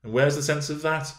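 put-on high, peevish girlish retort; peevish young miss. A narrator's reading. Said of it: "in a peevish tone".

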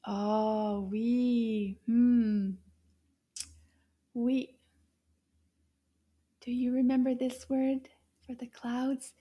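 normal talking voice

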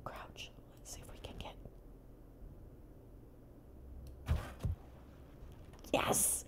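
a whispered cheer; whispered win kept quiet; whispering. Real, not acted. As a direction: celebrate silently. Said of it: "I can't celebrate too loudly".

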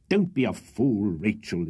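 slow, rough scolding; rough rebuke. A narrator's reading. Wardle to his hysterical sister, roughly. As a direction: cut in with a blunt, drawn-out rebuke. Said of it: "interposed Mister Wardle rather more roughly".

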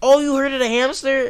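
high-pitched imitation of a complaining viewer; mock outraged commenter. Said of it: "people were probably gonna say".

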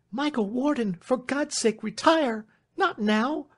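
very high, rising plea; urgent alarm. Acted. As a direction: beg him to go before he is seen, pitch high. Marian urging a hidden man away.

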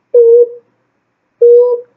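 high loud beep sound; mock beep. Girl beeping as she turns off the camera, loud mock beep. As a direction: beep it out like a censor.

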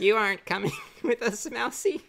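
character voice. Acted. Voicing a character to mouse.

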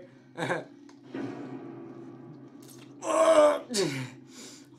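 shrieking nervous laugh; panicked laughter. Real, not acted. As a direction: laugh and cry out. After the scare.

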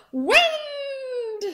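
high, loud squeaky cry; comic punchline. Acted. Punchline gasp joke.

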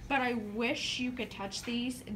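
ordinary delivery

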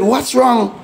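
high, startled what's wrong; sudden alarm at the coughing man; alarmed question. Retold or imitated. Pastor alarmed as his possessed relative starts coughing.

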